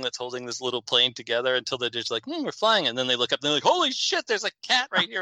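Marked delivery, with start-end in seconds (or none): 2.2-2.9 mock calm passenger. Cat video people voiced. 3.6-5.2 high mock panic at the cat; a hammed-up high yelp of alarm; mock shocked. Passenger spotting a cat on the plane, mock high panic.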